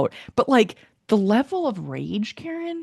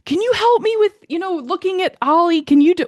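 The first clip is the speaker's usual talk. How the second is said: higher, tense mock request, a touch of snapping; imitating mean tone; 11 semitones higher, 4 dB louder than usual. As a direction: replay a snippy demand to your partner, half-hearted. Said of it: "I don't remember how mean I was".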